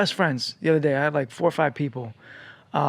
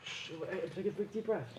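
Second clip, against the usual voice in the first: much softer, calm instructions; soothing coaching; 3 semitones higher, 20 dB quieter than usual. Real, not acted. Friend coaches him to breathe, softly. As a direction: talk him down to relax.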